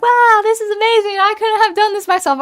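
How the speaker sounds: put-on high, loud, gushing girl voice; mock amazed high voice. Imitating the pianist gushing over her AI track. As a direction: gush like she's blown away by the result. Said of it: "And she's like".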